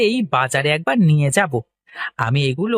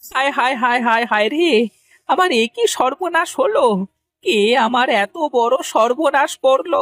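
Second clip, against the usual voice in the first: high, theatrical lament; hammed-up crying; wailing; 6 semitones higher than usual. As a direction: wail over your ruin in a raised pitch. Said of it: "এভাবে কাঁদছো কেন?".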